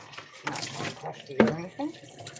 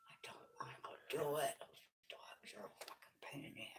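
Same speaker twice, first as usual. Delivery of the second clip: whispering; 4 semitones lower, 17 dB quieter than usual. Real, not acted. Complaining under her breath.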